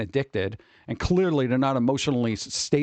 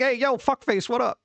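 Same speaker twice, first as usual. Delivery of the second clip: rowdy greeting; 10 semitones higher than usual. Retold or imitated. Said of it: "People like".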